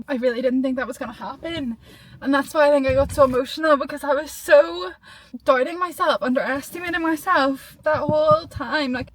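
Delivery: ordinary talk, nothing marked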